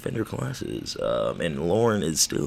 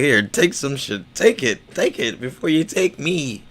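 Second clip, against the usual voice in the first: mocking quote voice; 6 semitones higher, 3 dB louder than usual. Acted quote of people.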